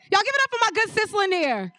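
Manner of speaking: high, fast call for applause; rally the crowd to cheer; hype introduction. Real, not acted. Host bringing up her good sis, excited hype call.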